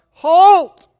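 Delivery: a huge high shout; yelled command. Acted. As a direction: scream halt across the army. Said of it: "Alexander the Great yelled out".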